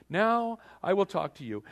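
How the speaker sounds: king granting audience, high and loud; mock royal grant. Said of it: "he would hold out his golden scepter and he would say".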